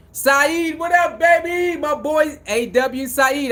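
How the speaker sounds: loud, high greeting called out to friends; excited shout-out. Real, not acted. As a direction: hail your viewers by name, pumped. Shouting out to live chat viewers.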